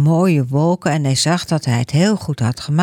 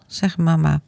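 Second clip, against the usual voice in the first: ordinary delivery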